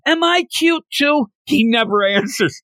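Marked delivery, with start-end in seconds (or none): 0.1-1.3 put-on high pleading girly voice; needy girl. Sayori wanting Jean-Marc's attention, high and pleading. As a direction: beg for attention, pitched up. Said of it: "You end up having Sayori".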